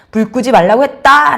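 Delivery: stern fatherly warning; angry dad. Acted. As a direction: repeat the order as a dad losing patience. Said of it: "아빠가 화났다는 걸".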